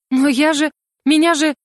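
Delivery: high, faltering objection; bewildered protest. A narrator's reading. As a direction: break off, lost for words. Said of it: "Я растерянно вгляделась". (растерянно, высоким голосом)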